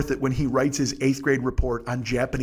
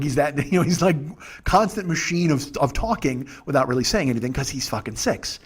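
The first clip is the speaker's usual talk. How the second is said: ordinary talk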